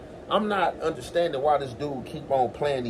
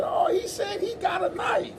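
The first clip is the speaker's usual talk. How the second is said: high-pitched laughing cry of "knife"; mock laughing shout, much higher; 3 dB quieter than usual. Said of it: "I started laughing and said".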